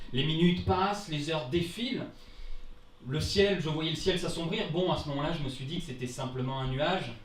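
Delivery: normal talking voice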